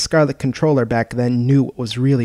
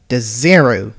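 louder, suspicious snap of his name; cold, wary naming; 7 dB louder, slower than usual. Acted. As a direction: say his name with narrowed eyes. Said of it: "narrowing her eyes".

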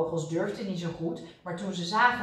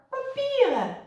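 very high, loud exclamation; squawked single word, pitched way up; calling out; 6 dB louder than usual. A narrator's reading. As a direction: cry it out in disbelief. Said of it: "riep Kwark".

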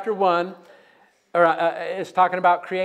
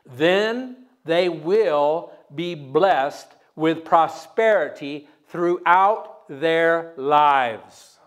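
solemn reading; much slower than usual. Real, not acted. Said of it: "slow solemn scripture reading".

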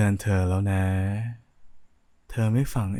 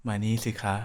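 usual speaking voice

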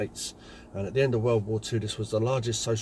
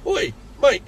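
loud gruff shout from behind; shouted call; 14 semitones higher, 9 dB louder than usual. Retold or imitated. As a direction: bark it out to get attention.

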